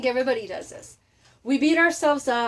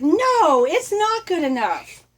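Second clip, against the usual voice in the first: louder and higher, slowly insisting it's not good enough; insistent refusal; 7 semitones higher, 6 dB louder, slower than usual. Retold or imitated. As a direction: push back hard on a brother who settles. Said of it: "but his brother's like".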